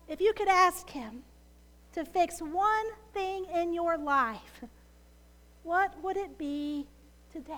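ordinary talk

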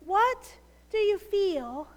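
ordinary talk

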